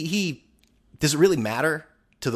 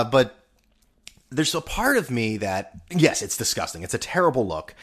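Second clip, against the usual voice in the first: normal talking voice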